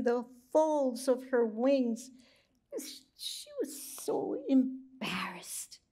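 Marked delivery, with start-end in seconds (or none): 3.2-5.8 soft whispered aside. A narrator's reading. Narrator whispering about the embarrassed Mama Duck. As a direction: hush it sympathetically.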